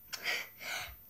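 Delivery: a quiet breathy laugh at her own joke; soft laugh. Real, not acted. Vlogger laughing off a ghost haunting her, quiet laugh. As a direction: laugh it off under your breath.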